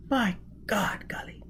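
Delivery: put-on high, hushed, disbelieving girl; incredulous whisper. A narrator's reading. Gisbella whispering to Foyle in disbelief at their escape. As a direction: whisper it in wonder. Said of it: "whispered incredulously".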